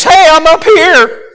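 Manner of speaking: high, loud, drawn-out holler. Retold or imitated. Crash victim up a tree hollering to rescuers. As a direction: call out from up high to people below. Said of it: "he's hollering at us".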